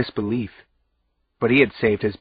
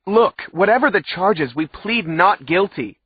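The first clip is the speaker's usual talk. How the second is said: yelled; 9 semitones higher, 5 dB louder than usual. A narrator's reading. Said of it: "I yelled".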